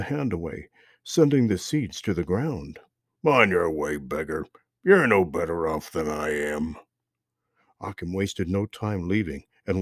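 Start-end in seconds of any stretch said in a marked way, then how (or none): 3.2-6.8 low, dismissive thief voice; gruff robber. Acted. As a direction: wave him off with contempt.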